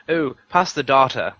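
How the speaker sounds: "data" said as "tah-ter" in a mock English accent, a bit slow; lightly mock her strange half-English accent on "data"; imitating odd English accent. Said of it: "she'd pronounce a word in an English accent".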